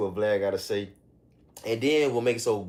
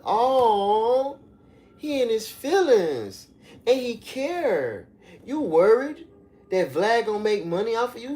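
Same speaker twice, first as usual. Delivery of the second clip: high teasing voice, slow at first; mock sympathy; 10 semitones higher, 5 dB louder than usual. Jeering that [name] is in his feelings, high mock pity. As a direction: tease him like a hurt child.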